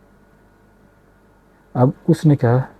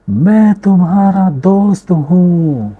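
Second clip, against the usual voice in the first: slow, high, loud call to the hills; father calling out toward the mountains; 9 semitones higher, 6 dB louder, slower than usual. Acted. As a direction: stretch the words out far across the valley.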